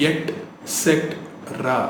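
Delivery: et cetera broken as et, set, ra; syllable by syllable. Shown as a demonstration. Teacher breaking the word down, syllable by syllable. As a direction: say et cetera in separated pieces. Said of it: "எக்ஸே கிடையாது அதுல".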